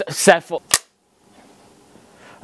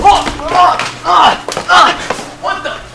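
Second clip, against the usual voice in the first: high, loud startled oh; shocked exclamations; surprised cries; 5 dB louder, much slower than usual. Acted.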